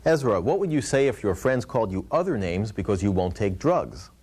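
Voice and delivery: ordinary talk, nothing marked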